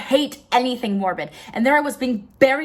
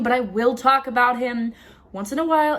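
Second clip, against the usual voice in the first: normal talking voice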